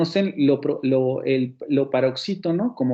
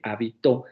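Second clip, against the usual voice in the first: "habito" stressed on TO, as ha-bi-TÓ; final stress in "habito", sounding ha-bi-TÓ; stress on last syllable; 3 semitones lower than usual. Shown as a demonstration. Teacher contrasting words with identical phonemes, "habito" stressed on final TO. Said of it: "en la última sílaba".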